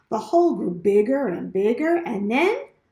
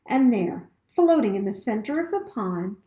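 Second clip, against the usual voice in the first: plain reading voice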